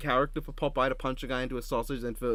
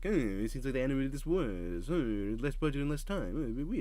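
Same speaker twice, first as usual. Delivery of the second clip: muttered, wavering cartoon sailor grumble; Popeye impression; 2 semitones higher than usual. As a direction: mumble it like an old cartoon sailor. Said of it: "Popeye was just like".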